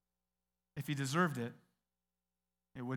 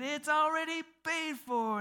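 sing-song cashier; 12 semitones higher, 3 dB louder, slower than usual. Retold or imitated. Cashier tells the proud customer.

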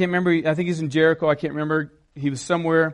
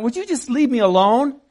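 higher, mildly exasperated question; irritated brush-off; 5 semitones higher than usual. Acted. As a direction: snub someone needing help, as a contrast. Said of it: "I can be that way".

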